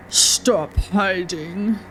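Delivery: very slow, low menacing order; slow menacing driver. Acted. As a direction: drag it out like a threat. Voicing the driver.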